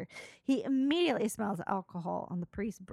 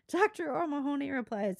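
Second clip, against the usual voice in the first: usual speaking voice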